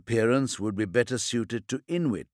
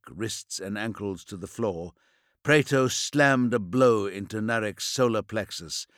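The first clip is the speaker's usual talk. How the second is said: ordinary delivery, nothing marked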